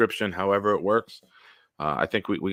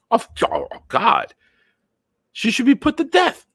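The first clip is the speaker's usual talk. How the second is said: much higher, loud outraged cry; 13 semitones higher, 7 dB louder than usual. Real, not acted. Reacting to the dead-man video, loud and outraged. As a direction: erupt in disgusted disbelief.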